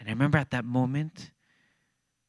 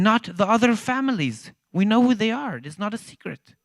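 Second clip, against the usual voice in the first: angry ambassador; 7 semitones higher, 7 dB louder than usual. Retold or imitated. Ambassador gets angry, retold.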